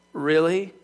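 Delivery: low, questioning deadpan; sceptical. Acted. God answering Elijah's claim, sceptical.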